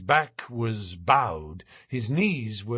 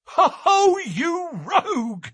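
high, loud hearty laugh, then slow teasing; a policeman tickled by a good trick; delighted laugh; 4 dB louder, slower than usual. A narrator's reading. Jones laughs at Holmes's disguise, delighted. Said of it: "cried Jones, highly delighted".

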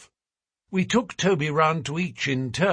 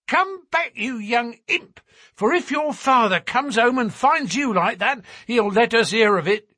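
high, louder mother's telling-off; shouted scolding; 10 semitones higher, 4 dB louder than usual. A narrator's reading. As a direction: scold a runaway child at full pitch. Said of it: "she shouted".

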